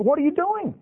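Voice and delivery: high-pitched startled challenge, acted out; alarmed questioning. Retelling priest confronting idol thieves.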